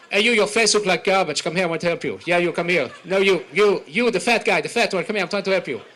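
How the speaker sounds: put-on loud, brash Israeli accent, hawking at a passerby; pushy Israeli seller. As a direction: bark at the customer like a mall kiosk seller.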